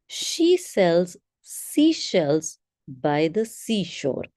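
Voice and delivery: slow, crisp sibilants in "She sells seashells by the seashore"; clear tongue twister. Shown as a demonstration. Teacher showing clarity on a tongue twister, slow and crisp. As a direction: say every s and sh sound slowly and distinctly. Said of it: "check the clarity of your sound".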